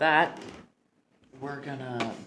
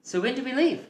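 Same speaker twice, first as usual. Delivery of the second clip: high, eager cub voice; bright eager high question; lion cub voice. Acted. NPC eager to join.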